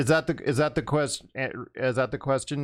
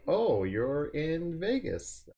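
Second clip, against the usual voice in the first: slow sarcastic; 7 semitones higher, 3 dB quieter, much slower than usual. Retold or imitated. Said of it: "I'm like".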